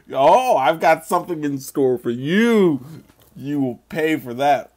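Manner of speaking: loud hammed-up villain threat; mock vengeful gloating threat; boss rage phase. Playful villain threat at voter.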